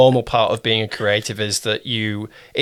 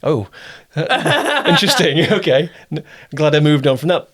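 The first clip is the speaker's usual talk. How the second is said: laughing at own work; 4 semitones higher than usual. Retold or imitated. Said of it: "it's like".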